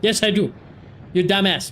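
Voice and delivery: usual speaking voice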